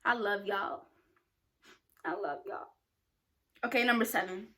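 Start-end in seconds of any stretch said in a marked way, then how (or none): 2.0-2.7 softer, lower, almost to herself; a quiet, tender echo of the first take; soft repeat. Real, not acted. Repeating affectionate sign-off.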